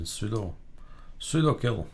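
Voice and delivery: ordinary talk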